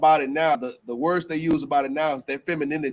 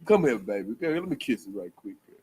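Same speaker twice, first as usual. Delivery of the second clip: tender comforting; 9 semitones lower, 4 dB quieter than usual. Pretend. Comforting a crying woman.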